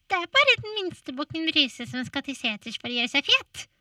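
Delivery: high, timid little goat. Acted. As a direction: answer small and meek. Smallest goat answers troll.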